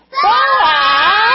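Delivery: put-on leading the kids in a big yell of Boaz!; yell as loud as you can. Shown as a demonstration. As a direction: shout Boaz! at the top of your lungs. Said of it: "Yell as loud as you can with me".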